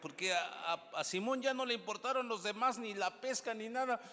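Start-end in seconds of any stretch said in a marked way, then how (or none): 0.0-1.7 very loud, high-pitched pulpit call; rally the congregation at full volume; booming exhortation to the church; rousing preaching. Acted.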